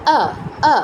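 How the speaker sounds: আ as a short, unrounded schwa; Hindi-style short vowel. Shown as a demonstration. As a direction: say each আ short, lips spread, like Hindi. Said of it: "হিন্দিতে অ এর উচ্চারণটা যেমন". (হিন্দির মতো ছোট, না-গোল আ)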